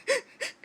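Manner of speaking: sharp high gasp like ugly crying; sobbing gasp demo. Shown as a demonstration. Showing her vlog viewers her ugly crying gasp. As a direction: gasp in as if mid-sob. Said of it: "that ugly crying where you're like".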